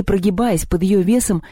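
ordinary delivery

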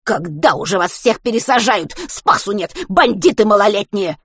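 relentless ranting. A narrator's reading. Old woman railing at juvenile bandits, outraged. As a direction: keep railing at young hooligans. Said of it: "не унималась женщина".

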